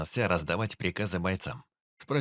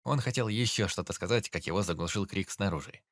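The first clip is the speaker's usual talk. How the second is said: usual speaking voice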